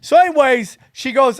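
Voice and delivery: usual speaking voice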